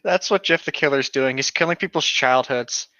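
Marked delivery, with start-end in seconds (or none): none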